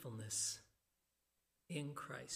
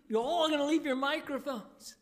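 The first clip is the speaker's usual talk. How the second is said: laughing joke; 8 semitones higher, 7 dB louder than usual. Acted. Joke ad-lib in Jesus voice.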